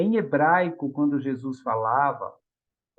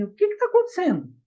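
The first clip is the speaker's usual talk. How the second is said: much higher, quick teacher's voice; gently asking the children what happened; 14 semitones higher, much faster than usual. Retold or imitated. Teacher questioning a crying child's classmate, high and quick. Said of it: "falou assim".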